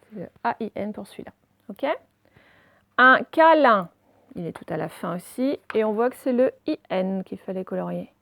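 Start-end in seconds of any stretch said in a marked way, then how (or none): 3.0-3.9 loud, measured dictation of each syllable. Shown as a demonstration. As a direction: sound the word out for pupils. Teacher sounds out exercise word.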